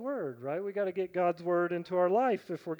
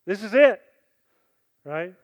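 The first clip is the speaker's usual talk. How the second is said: ordinary delivery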